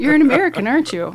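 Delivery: a teasing French shopkeeper calling out the American; mock French local. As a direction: playfully voice a local who spots the tourist. Voicing French locals spotting tourists.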